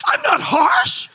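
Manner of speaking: high, loud, slow indignant denial; mock protest. Preacher denying wife's remark, loud high mock protest. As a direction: protest way too hard, laughing. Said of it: "And I'm like".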